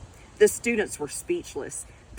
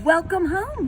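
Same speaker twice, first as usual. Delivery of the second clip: cheery welcome; 10 semitones higher, 6 dB louder than usual. Acted. Mother greets daughter cheerfully.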